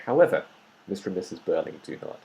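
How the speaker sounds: a firm "However," then a clear pause before "Mister and Missus Burling do not."; emphatic pause. Shown as a demonstration. Teacher shows students an emphatic pause after "However,". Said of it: "So it sounds a bit more, um, emphatic".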